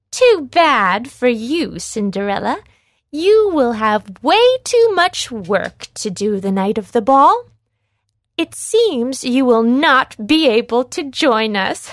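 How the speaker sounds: loud, taunting sneer at Cinderella; mocking stepsister. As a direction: jeer at her, gloating and mean.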